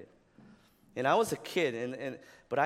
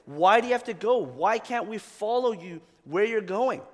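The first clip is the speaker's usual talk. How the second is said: voiced panicked disciples, pitched up and louder; freaking out; 6 semitones higher, 6 dB louder than usual. Disciples panicking at Jesus leaving, mock frantic. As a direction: play the frightened disciples pleading. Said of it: "freaking out".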